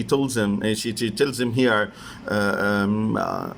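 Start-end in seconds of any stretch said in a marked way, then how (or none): none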